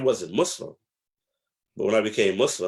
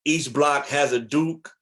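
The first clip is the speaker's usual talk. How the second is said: slow and a little higher, each word set down; slow emphasis; 5 semitones higher, 4 dB louder, slower than usual. Real, not acted. Telling the host how the streets worked, slow and weighty. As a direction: lay down the rule slowly. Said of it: "slow emphatic point".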